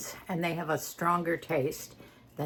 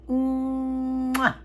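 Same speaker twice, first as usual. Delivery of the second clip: chef's kiss; 7 semitones higher, 5 dB louder than usual. Real, not acted. Chef's kiss over finished pasta.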